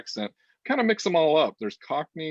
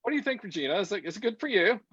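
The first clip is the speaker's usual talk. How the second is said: put-on British accent, pitched higher; 9 semitones higher than usual. Shown as a demonstration. Host showing a co-host she can do the accent, British accent. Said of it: "Can you do the accent".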